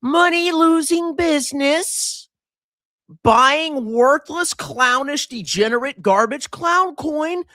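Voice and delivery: high, slow, mock-thoughtful voice for the market; the market talking. Mocking the market's verdict, high and slow. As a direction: play the market weighing up a bad company. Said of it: "And the market says".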